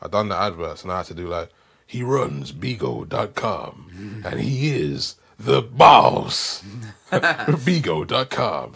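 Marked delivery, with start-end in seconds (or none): 1.9-8.8 slow, drawn-out American ad voice; trailer-style American accent read. Retold or imitated. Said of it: "putting on the whole American accent".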